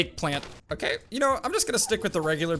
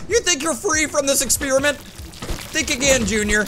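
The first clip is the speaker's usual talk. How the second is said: higher, hammed-up mad-scientist gloat, slowing on the last words; mock villain taunt; 7 semitones higher than usual. Gamer taunts a transformed NPC in a mock villain voice. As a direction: gloat like a cartoon villain, dragging out think again.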